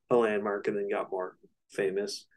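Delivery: normal talking voice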